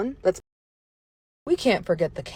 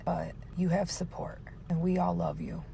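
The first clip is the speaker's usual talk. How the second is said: whispering; 2 semitones lower, 8 dB quieter than usual. Real, not acted. Mom whispers support to her son.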